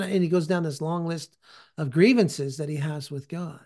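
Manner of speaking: normal talking voice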